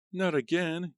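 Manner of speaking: very high loud cry; high loud dismayed cry; cried. A narrator's reading. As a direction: shriek it in dismay. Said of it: "Gail cried".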